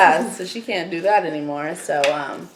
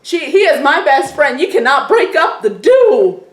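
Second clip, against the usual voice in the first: loud, higher bossy demand to Allie; mock stand-up speech; 6 semitones higher, 6 dB louder than usual. Best friend telling off Allie, loud and high. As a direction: stand up to her, raise the voice. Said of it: "you stand up to her".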